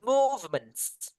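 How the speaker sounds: high, clear model words for the class to echo; repeat-after-me drill. Shown as a demonstration. Teacher leading the class in a repeat-after-me drill, high and clear. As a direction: call out each word to be repeated. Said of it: "repeat after me".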